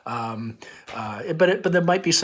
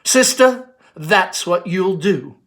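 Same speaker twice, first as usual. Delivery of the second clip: louder, higher burst of renewed energy, then slow; perking up with sudden hope; spark of revival; 6 semitones higher, 9 dB louder, slower than usual. Acted. Mother rallying her daughter with renewed spark. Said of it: "with a spark of revival".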